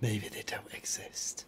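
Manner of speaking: slow, soft conspiratorial whisper. Acted. Teasing her sister about the kids, mock whisper. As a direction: breathe it like a secret.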